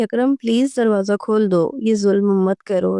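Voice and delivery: usual speaking voice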